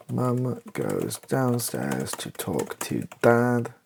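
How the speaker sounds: ordinary talk, nothing marked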